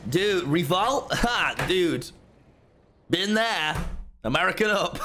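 put-on over-the-top American accent; fake American accent. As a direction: play a cartoonish American host. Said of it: "if I do an American accent".

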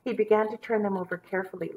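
ordinary delivery

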